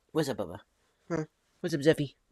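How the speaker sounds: ordinary delivery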